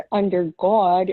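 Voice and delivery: thick New York vowel on "God"; rounded, drawn-back Long Island "God"; Long Island accent. Retold or imitated. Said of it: "I should say Long Island accents".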